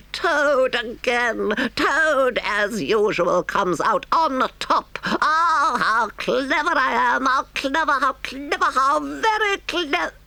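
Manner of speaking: very high, drawn-out crowing; ecstatic self-admiration. A narrator's reading. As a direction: gloat rapturously over your own cleverness. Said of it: "He cried in ecstasies of self-admiration".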